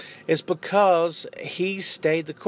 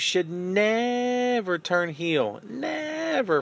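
NEVER stretched out, then a high, emphatic NEVER; 6 semitones higher, much slower than usual. Real, not acted. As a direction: lean hard on the word never. Insisting Sting stays a babyface.

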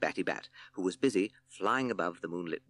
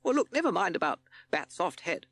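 lightly voiced captain, higher than the narration; brisk leader; 11 semitones higher, 4 dB louder than usual. A narrator's reading. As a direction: pitch it up like a leader taking charge. Captain calls band to order.

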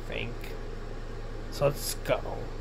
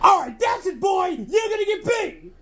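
high angry yelling; angry shouting; 7 semitones higher than usual. Acted. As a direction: shout the threat. Threatening the cat.

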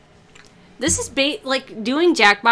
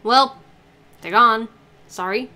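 ordinary delivery

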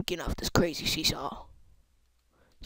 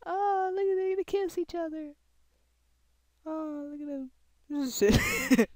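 mock voice; 10 semitones higher than usual. Mocking voice at figures.

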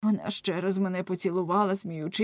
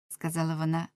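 ordinary delivery, nothing marked

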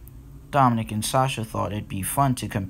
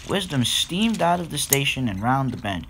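plain reading voice

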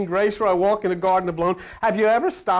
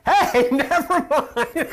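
higher, very slow, laughing call; a God laughing off his own trick; 7 semitones higher, much slower than usual. Acted. God calls off the killing, slow and laughing.

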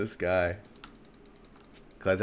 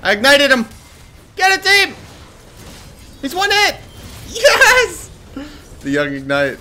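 very high, loud triumphant yells; excited shouting; 13 dB louder than usual. Real, not acted. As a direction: scream the kill to your team. Winning kill in team fight.

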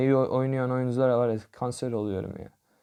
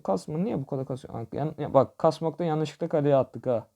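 ordinary delivery

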